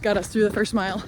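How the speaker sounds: talking on the run, loud; while running. Real, not acted. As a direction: call it out mid-run. Mid-run update.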